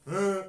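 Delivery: high, loud grunt as if zapped; loud shocked "uh"; jolted grunt. Shown as a demonstration. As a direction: grunt sharply like a taser hit. Demonstrates being poked by taser.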